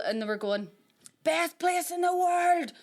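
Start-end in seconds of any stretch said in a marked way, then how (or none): 1.2-2.7 high, slow mock local boast; proud Shankill locals. Locals answering a question about the Shankill, mock-proud. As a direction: drawl it out as a loyal resident.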